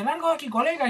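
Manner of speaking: high, menacing townsman; mock threatening townsman. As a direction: run him out of town. Mob voiced in retold comic.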